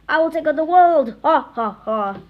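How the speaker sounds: loud, high, hammed-up villain boast; evil villain gloat. Wedgie Woman vowing to conquer the world, hammy and loud. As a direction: gloat like a cartoon supervillain.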